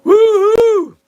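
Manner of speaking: high, loud cheering whoop; excited whoop. Real, not acted. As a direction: let out a big happy woohoo. Cheering on stream.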